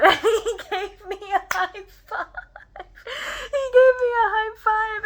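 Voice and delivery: high, loud squeal through laughter; thrilled laughter. Real, not acted. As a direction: laugh while gushing it out. Laughing, thrilled over the high five.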